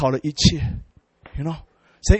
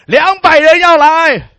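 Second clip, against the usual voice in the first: slow, higher and louder, amazed; excited announcement; 7 semitones higher, 6 dB louder, slower than usual. Retold or imitated. Pastor tells John the whole church is coming, excited and slow. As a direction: stretch it out as if breaking big news.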